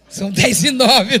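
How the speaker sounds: mais alto, devagar, rindo. Real, not acted. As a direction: diga a hora entre risadas. Ri da hora avançada. (rindo com a plateia da hora já avançada)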